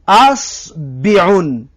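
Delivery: اسبعون slowly as asbiun with i on the b; drawn out اسبعون, open a then i; third variant. Shown as a demonstration. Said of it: "سه تا دیگر".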